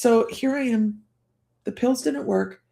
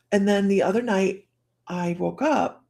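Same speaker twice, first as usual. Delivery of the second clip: ordinary delivery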